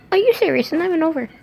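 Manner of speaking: high-pitched disbelief; can't believe the story goes on; shocked, high-pitched complaint; incredulous. Real, not acted. Reader annoyed at story.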